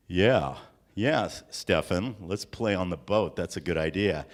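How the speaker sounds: imitating deep voiced friends; deep teenage friends. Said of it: "And they said to me".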